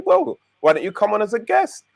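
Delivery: normal talking voice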